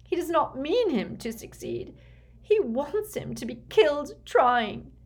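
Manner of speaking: high, anguished despair; choked. A narrator's reading. Mother certain her son will die, choked and distraught. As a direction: cry out in grief, pitched well up. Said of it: "choked Narcissa".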